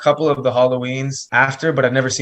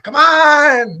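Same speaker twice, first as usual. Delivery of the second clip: loud exasperated shout; yelling at screen; 6 dB louder than usual. Retold or imitated. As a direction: throw your hands up and yell.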